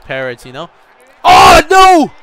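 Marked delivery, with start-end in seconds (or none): 1.2-2.1 very loud, high-pitched cry of dismay; real dismayed shout. Real, not acted. Gamer reacting to a bad moment in play, dismayed yell. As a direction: yell out as the play goes wrong.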